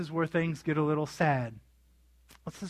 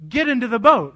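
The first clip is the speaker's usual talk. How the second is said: louder, higher repeated order; insistent command; 5 semitones higher, 8 dB louder than usual. Retold or imitated. Jesus overruling the disciples' protest, insistent command. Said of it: "He made them".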